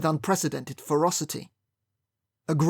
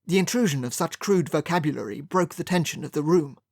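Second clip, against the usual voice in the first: ordinary delivery, nothing marked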